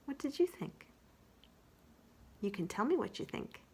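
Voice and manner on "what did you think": plain reading voice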